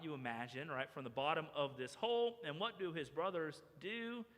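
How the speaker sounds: normal talking voice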